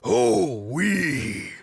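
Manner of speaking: put-on a loud French-accented growl of Oh, oui; French Macho Man Randy Savage. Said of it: "That's my French Macho Man Randy Savage impression".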